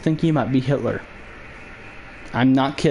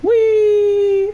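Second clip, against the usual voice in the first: high squeal; 6 dB louder than usual. Real, not acted. Squealed cry.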